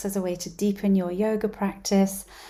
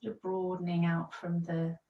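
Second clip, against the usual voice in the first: soothing guided relaxation read; guided yoga practice. Acted. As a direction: talk them gently down into rest.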